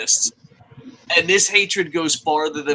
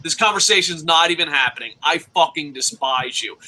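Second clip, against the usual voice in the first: ordinary talk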